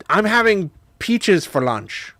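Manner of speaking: put-on high, loud small-child voice; imitated little daughter. Parent imitating daughter chatting to Nemo, high child voice. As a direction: pipe it up like a chatty toddler. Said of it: "she'll just sort of be like".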